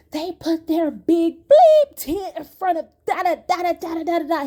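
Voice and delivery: high, loud imitation of a husband going off; mock husband rant. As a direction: rant like an outraged partner, trailing into gibberish. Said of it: "it was like zero to a hundred".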